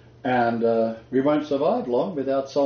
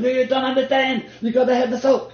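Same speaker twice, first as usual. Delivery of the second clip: insistent husband; 10 semitones higher, 3 dB louder than usual. Retold or imitated. Husband protesting to his wife, high insistent mock voice. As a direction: play the husband protesting he needs his salt. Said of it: "He's going".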